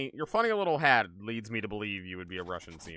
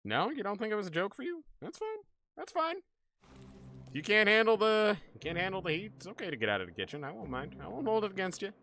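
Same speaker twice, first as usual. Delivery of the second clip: higher, sing-song mock wounded tone; mock hurt; 11 semitones higher, faster than usual. Streamer teasing a viewer who left, high mock hurt. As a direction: pretend to be offended, playfully. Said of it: "teasing departing viewer".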